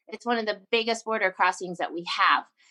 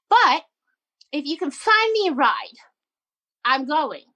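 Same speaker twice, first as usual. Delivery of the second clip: louder and higher, daring God to answer; defiant ultimatum; 5 semitones higher, 4 dB louder than usual. Retold or imitated. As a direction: throw down the last condition of the deal, louder. Defiant deal struck with God.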